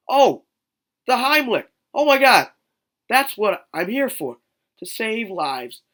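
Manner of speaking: over-the-top excited shout; mock eureka. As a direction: burst out like a cartoon hero realizing his power. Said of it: "He was like".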